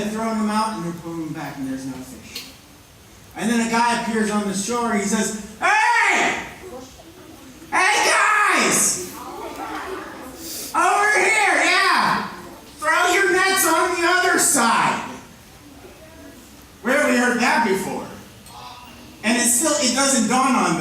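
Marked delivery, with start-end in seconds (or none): 5.6-15.0 calling out. Acted. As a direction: shout from the shore to the boat. Man calling from the shore.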